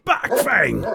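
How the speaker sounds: sharp high call to a big dog. A narrator's reading. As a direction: bark the command at the dog. Hagrid calls off his dog.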